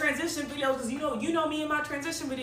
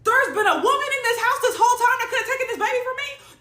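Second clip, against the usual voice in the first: high, loud incredulous outburst; outraged disbelief; 9 semitones higher, 7 dB louder than usual. Real, not acted. Client learns another woman could take the baby, outraged disbelief. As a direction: blow up in disbelief.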